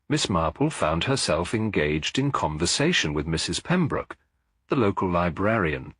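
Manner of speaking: ordinary delivery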